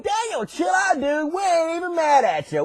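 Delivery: high, soft, chilled-out reassurance; placating lion. Acted. Lions telling Daniel to chill out, placating.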